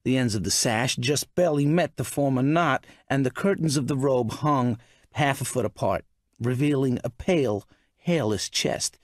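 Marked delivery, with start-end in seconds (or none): none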